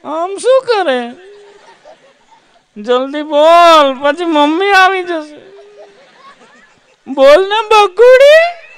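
put-on high, slow coy girl voice, giggling; a high, drawn-out lovesick girl on the phone; coy girlfriend. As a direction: coo slowly like a shy fiancee. Girl voiced cooing to fiance.

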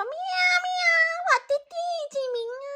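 high, very fast meowing cat voice; wet cat. Acted. Drenched cat asking the Jade Emperor its place, high meowing. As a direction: meow the question like a soaked kitten. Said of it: "问说".

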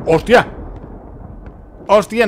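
sudden high, loud startled cry; startled exclamation. Real, not acted. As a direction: jolt of fright, shout it out. Jump scare in horror game.

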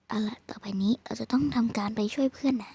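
soft breathy whisper, no voice. Pretend. Whispering the plan to her partner so the killer won't hear. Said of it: "มากระซิบกัน".

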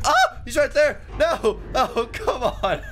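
high-pitched, alarmed protest; startled cry. Real, not acted. Player caught by the neighbor in a game, startled. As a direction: yelp in dismay as you get spotted.

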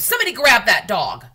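loud frantic yell; manager screaming. A narrator's reading. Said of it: "The manager screamed".

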